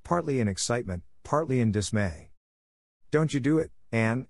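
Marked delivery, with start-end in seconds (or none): 3.1-4.3 higher-pitched, mild plea; entreating. A narrator's reading. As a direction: plead urgently but without overacting. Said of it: "entreated Diana".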